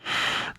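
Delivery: voiceless giggle; whispered laugh. Real, not acted. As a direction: laugh under the breath in a whisper. Laughs at own silly image.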